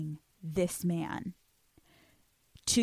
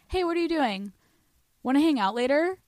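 breezy casual; 6 semitones higher than usual. Pretend.

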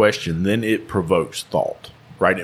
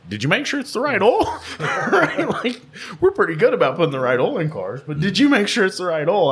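laughing disbelief; 11 semitones higher than usual. Retold or imitated. Teasing retold question, laughing.

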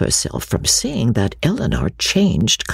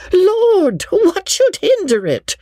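very high-pitched exclamation of disbelief; shocked outcry; 3 dB louder, slower than usual. A narrator's reading. Mrs Jennings, shocked at so unlover-like a speech, high astonished outcry. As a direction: cry out, astonished, voice leaping right up. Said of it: "she was almost ready to cry out".

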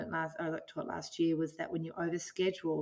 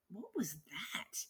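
whispered, slow and breathless; hushed whisper; 10 dB quieter, slower than usual. Retold or imitated. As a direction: whisper it as if hearing a voice. Hearing words in meditation.

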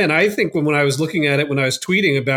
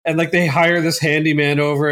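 usual speaking voice

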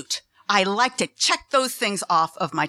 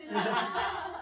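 soft laugh; 8 semitones higher, 10 dB quieter than usual. Real, not acted. Laughs at finding Francois.

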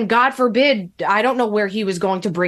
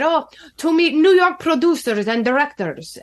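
higher-pitched imitation Russian accent; 5 semitones higher than usual. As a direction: put on his heavy Russian accent. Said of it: "had a very heavy Russian accent".

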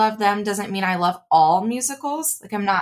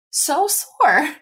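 exaggerated whine; 11 semitones higher, much slower than usual. Real, not acted. Complaining about dance soreness.

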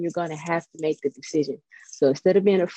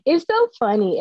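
amused; 7 semitones higher, 4 dB louder than usual. Real, not acted. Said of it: "that makes me laugh".

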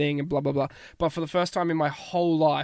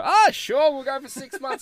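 breezy, much higher, louder "sure, why not" voice of his past self; carefree self; 9 semitones higher, 4 dB louder than usual. Retold or imitated. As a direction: toss it off like nothing matters. You've heard me just kind of go with the flow.

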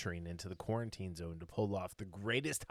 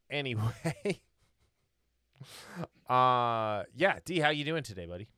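normal talking voice

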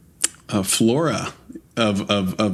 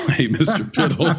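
breaking into laughs on the punchline; giggling as you repeat the line; laughing; 8 semitones higher than usual. Real, not acted. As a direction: quote "Hey, Mister Piddle" through real laughter. Laughing while quoting funny line.

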